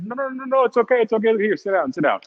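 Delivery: high, coaxing no, no, it's okay; coaxing shopkeeper. Retold or imitated. Shopkeeper coaxing customer to sit down, high and coaxing. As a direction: imitate a salesman calming you into a seat.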